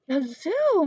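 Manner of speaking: loud, high cry of dismay; miserable exclaim. A narrator's reading. Missus Prim hearing Lyle goes to the zoo, dismayed cry. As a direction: gasp it out in despair. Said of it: "Missus Prim exclaimed miserably".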